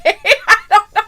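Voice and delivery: real laughter breaking in; giggling at the absurd promo; laughing. Real, not acted.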